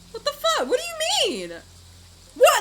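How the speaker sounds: high, disbelieving cry; shocked disbelief; stunned outburst. Real, not acted. Stunned reaction to plot twist.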